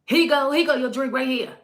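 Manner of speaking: loud, high, sassy handing over; attitude. Retold or imitated. Imitating a waitress serving drinks, loud with attitude. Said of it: "she had this attitude".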